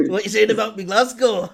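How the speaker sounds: angry Scotsman. Acted. Host imagines a Scotsman's voicemail, mock angry Scottish voice. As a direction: play an irate Scotsman confronting someone, for laughs. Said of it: "angry voicemail from a Scotsman".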